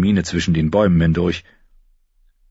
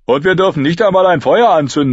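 high, louder grumble; complaining boy; 11 semitones higher, 6 dB louder than usual. A narrator's reading. A boy complains about the fire ban, grumbling. Character voice.

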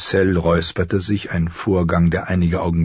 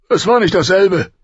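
much higher, loud protesting king; complaining king; 13 semitones higher, 6 dB louder than usual. Acted. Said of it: "beschwerte sich Robert". (beschwert sich, laut und hoch)

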